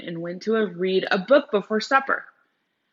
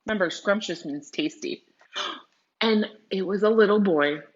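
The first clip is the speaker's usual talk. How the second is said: ordinary delivery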